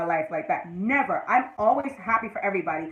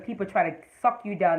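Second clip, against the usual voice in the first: normal talking voice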